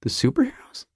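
tentative whisper to oneself; whispered guess. Real, not acted. Guessing what the initials stood for, whispered to oneself. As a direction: whisper it questioningly.

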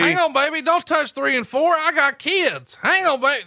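high hammed-up begging; mock pleading. Wrestler begging his opponent to spare him, high mock panic.

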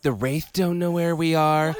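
high, bored, whiny leader voice; Elizabeth Weir whining. Retold or imitated. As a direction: whine like a bored commander. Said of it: "she was like".

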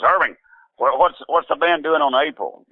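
high-pitched imitation of a woman demanding an answer; pointed demand. Wife demands answer from manager.

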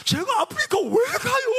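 mock shriek, pitched very high; Donghwan's wailing protest. Young man protests being sent to Africa, hammed-up wail. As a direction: imitate a whining, high-pitched cry of protest. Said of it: "제가 똑같이 흉내낼게요".

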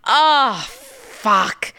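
long swooping "oh", then a dropped curse; retold dismay. Client realizing her boyfriend will notice, retold dismay. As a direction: groan out the sinking realization.

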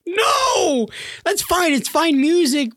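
very high, strained mock okay-ness; retold flustered reassurance. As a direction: squeak out a reluctant approval. Father's strained reply to son.

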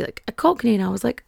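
ordinary talk

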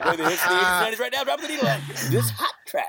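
loud high hyped radio host; morning DJ voice. Retold or imitated. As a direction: sell the hits like a morning jock. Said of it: "my morning D J voice".

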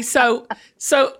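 ordinary talk

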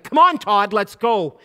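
loud, high, fired-up urging; rally him hard, half shouting; an impatient pastor pushing a friend to act, loud and high; worked up. Retold or imitated. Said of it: "he was pretty worked up".

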